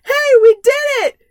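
high excited cheer; mock triumphant shout; cheering characters. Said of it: "And they're like".

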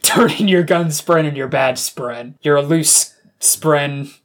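hammed-up dressing-down from the boss; angry police chief. Chief to loose-cannon cop over gun and badge, mock stern.

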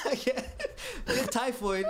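can't get the words out without laughing. Real, not acted. Telling the host about typhoid, laughing. Repeats punchline while laughing.

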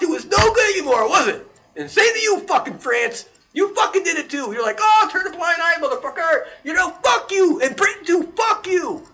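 high, loud furious rant; angry rant. Real, not acted. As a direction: shout it at the countries. Ranting rant against Europe.

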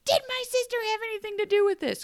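high, fast, frantic question; mock Zelina shout. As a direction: rush it out high as a panicked Zelina. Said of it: "Zelina shouts".

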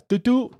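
high, loud "doo-doo" of arrows fired; playful high-pitched firing noise; imitated shooting noise. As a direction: voice quick shots as a sound effect.

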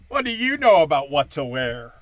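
normal talking voice

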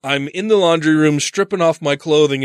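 normal talking voice

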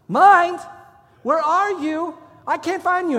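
high, loud calling out for a lost mind; recruit calling out. Retold or imitated. Recruit forced to call for his mind, loud calling out. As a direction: shout into the hall as if searching. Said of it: "calling for his mind".

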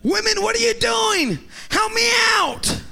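very high, loud shout for help; exasperated yell. Retold or imitated. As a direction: yell in hammed-up outrage at an idle wife. Yelling at wife taking pictures.

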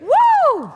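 high loud woo; excited whoop. Real, not acted. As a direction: whoop at the crowd. Preacher cheering the crowd.

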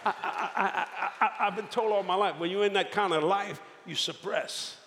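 high, laughing confession; embarrassed laughing admission; laughing through it. Real, not acted. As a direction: talk through the giggles. Laughing while admitting he can't cry.

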